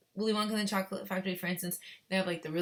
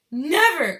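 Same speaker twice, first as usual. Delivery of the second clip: loud, high exclamation; emphatic cry; 9 semitones higher, 14 dB louder than usual. A narrator's reading. Wonka protesting to the mother, crying out. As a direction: cry out a flat-out refusal, loudly. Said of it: "cried Mister Wonka".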